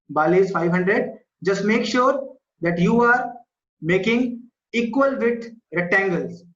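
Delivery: ordinary delivery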